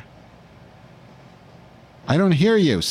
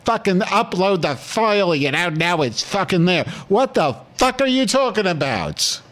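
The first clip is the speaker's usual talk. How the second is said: higher-pitched hammed-up tirade; mock angry rant; 9 semitones higher than usual. Host scolding Vera over the failed upload, hammed-up anger. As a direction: lay into her in playful fury. Host cuts in, keeps berating.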